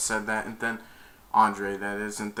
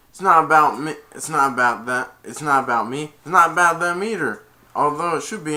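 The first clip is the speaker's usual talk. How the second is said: loud, higher, hammered repetitions; acted argument; 5 semitones higher, 9 dB louder than usual. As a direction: argue back at the coach. Retold argument with coach.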